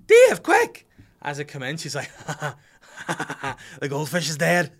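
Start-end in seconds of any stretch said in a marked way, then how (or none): 0.1-0.9 very high, loud urgent call; urgent shout. Retold or imitated. Wife calling him urgently, loud shout. 2.2-4.7 slow, laughing through the words. Retold or imitated. Wife telling him the fish died, laughing.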